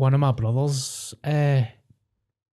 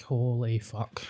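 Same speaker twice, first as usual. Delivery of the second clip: breathy, hushed, softer swear; whispered disgust; 7 dB quieter, much slower than usual. Real, not acted. Whispered disgust at people who smack their lips.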